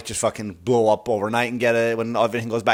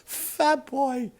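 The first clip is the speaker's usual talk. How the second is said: mock squeal; 12 semitones higher, 7 dB quieter than usual. Savouring the bike name.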